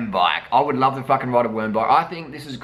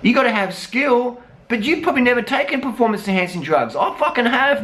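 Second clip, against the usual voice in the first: indignant rant; 10 semitones higher than usual. Real, not acted.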